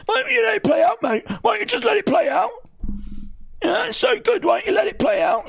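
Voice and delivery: very high, nasal-free mock whine of the crowd; mocking whiny fan. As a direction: sneer it up high like a petulant fan.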